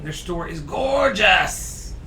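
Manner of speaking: gushing. Real, not acted. Gushing about the hosts' store.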